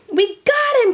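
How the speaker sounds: high, loud triumphant yell; triumphant shout. A narrator's reading. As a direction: shout the catch in victory. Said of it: "Kevin shouted".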